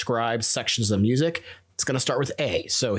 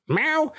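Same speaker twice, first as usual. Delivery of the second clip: high playful cat meow; 13 semitones higher than usual. Retold or imitated. Teacher imitating the song's cat for students, high rising meow.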